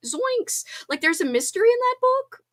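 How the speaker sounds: high, goofy Shaggy impression; a lightly voiced Scooby-Doo Shaggy, up high; playful cartoon stoner voice.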